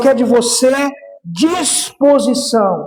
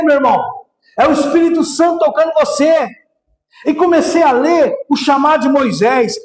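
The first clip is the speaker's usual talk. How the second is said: full-force preaching; 6 semitones higher than usual. Acted. Pastor urges the church, preaching at full force. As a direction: preach it out to the congregation.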